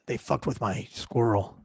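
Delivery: low, slow, soft mock action-hero growl; underplay it like a vengeful movie hero; brooding avenger. Host voicing John Wick meme, low slow menace.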